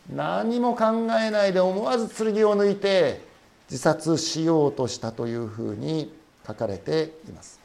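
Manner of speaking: ordinary delivery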